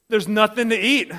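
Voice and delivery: exasperated, hammed-up hungry outburst; loud complaint. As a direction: complain loudly at the open fridge. Acting out hungry fridge complaint.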